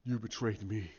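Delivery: loom over him, low and menacing, breathing the words; Snoke's voice. Retold or imitated. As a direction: ancient Sith lord gloating in a low hushed growl. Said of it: "He goes".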